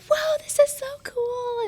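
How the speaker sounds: very high, stretched-out squeal of wonder; excited kids. Retold or imitated. Teacher imitating pupils feeding the fish, excited squeal. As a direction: imitate thrilled kids, pitch way up, slowly drawn out. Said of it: "yelling and screaming".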